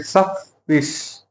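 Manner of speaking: सफिश with the double f pressed hard and held, slowly; slow सफिश with a heavy, held double f; doubled f stressed. Shown as a demonstration. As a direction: lean on the ff in सफिश, saying it slow. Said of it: "थोड़ा जोर लगा के दो बार बोलेंगे".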